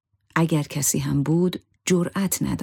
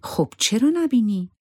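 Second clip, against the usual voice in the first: mildly upset, higher-pitched question; upset retort; 7 semitones higher than usual. A narrator's reading. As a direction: ask it with a touch of distress, voice lifting. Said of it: "زهره با ناراحتی جواب داد". (با کمی ناراحتی)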